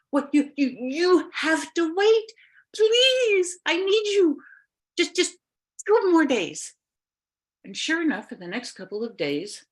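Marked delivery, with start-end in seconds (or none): 0.1-6.7 high pleading plea. Acted. Linnea pleads with the witch.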